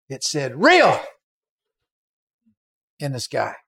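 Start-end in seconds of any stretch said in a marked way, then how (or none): 0.6-1.0 exclaimed reveal. Retold or imitated. Word appears in sky.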